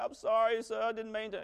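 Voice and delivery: high, lightly voiced apologetic kid; mild pleading child; hint of a sorry child. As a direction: play a caught schoolkid a little.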